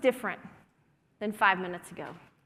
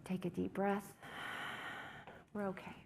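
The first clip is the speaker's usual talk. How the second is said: reassuring; 2 semitones lower, 14 dB quieter than usual. Real, not acted. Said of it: "calming the group, soft reassurance".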